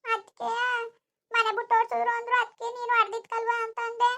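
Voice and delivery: put-on high, tearful child's explanation. Child explains the scolding to grandfather, tearful. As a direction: explain yourself plaintively, close to tears. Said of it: "ಅಳ್ಬೇಡ".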